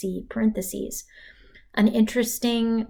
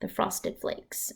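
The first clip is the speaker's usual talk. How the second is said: whisper; 8 dB quieter than usual. Shown as a demonstration. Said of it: "for added emphasis".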